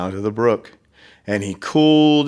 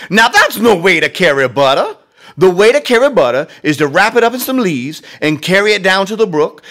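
put-on much higher, louder mama voice calling her boy; high, loud mother's voice scolding her son; 7 semitones higher, 6 dB louder than usual. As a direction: call him out like a fed-up mother.

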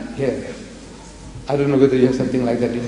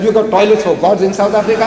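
joking retort; 5 semitones higher than usual. Retold or imitated. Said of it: "I said".

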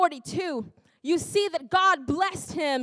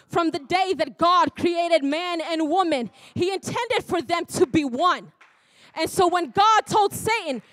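louder and higher, building to a shout; preaching at full force; 3 semitones higher, 7 dB louder than usual. Acted. Preacher driving the point home to the congregation, building to a shout. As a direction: preach it up to a climax.

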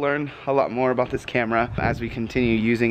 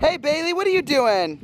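calling out; 13 semitones higher than usual. Real, not acted. Calling to kids at the pool.

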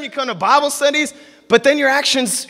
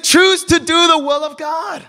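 proclaiming Jesus; 6 semitones higher than usual. Acted. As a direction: proclaim it like a command to the crowd. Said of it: "so he said".

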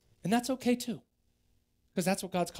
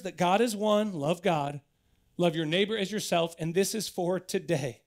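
normal talking voice

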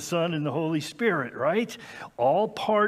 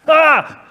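sudden loud high shout; startling boo; 12 semitones higher, 15 dB louder than usual. Pretend. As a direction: jump at them with a yell. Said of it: "Did I scare you?".

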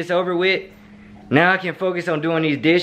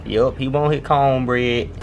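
ordinary talk, nothing marked

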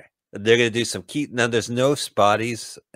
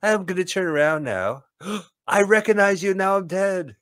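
movie victim; 10 semitones higher, 3 dB louder than usual. Acted. Voicing a movie victim getting shot.

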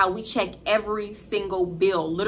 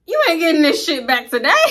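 louder, higher sassy comeback; defiant retort; 5 semitones higher, 6 dB louder than usual. Retold or imitated. Storyteller fires back at the customer, loud and sassy. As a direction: shut him down with sass.

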